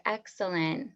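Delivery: ordinary delivery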